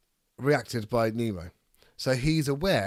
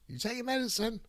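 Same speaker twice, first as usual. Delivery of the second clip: much higher, softer questioning voice of a partner; mock Hannah; 11 semitones higher, 4 dB quieter, faster than usual. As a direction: play the nagging partner checking up, pitched up high. Voicing Hannah checking on medicine.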